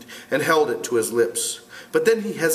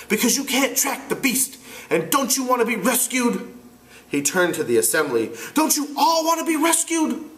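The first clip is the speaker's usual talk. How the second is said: put-on higher, frustrated boy leader; 12 semitones higher than usual. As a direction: argue as an exasperated boy. Ralph voiced.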